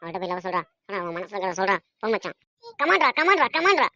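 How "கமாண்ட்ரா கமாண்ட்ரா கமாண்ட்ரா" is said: high, loud repeated cheer; egging on. Acted. Boy cheers friend on to propose, high excited chant. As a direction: chant it to spur a mate on.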